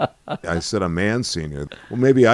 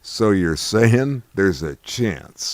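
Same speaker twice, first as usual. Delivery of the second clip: slow, drawn-out hopeful quote; Dumb and Dumber quote; much slower than usual. Retold or imitated. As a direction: stretch it out like the movie line. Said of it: "as they said in the movie Dumb and Dumber".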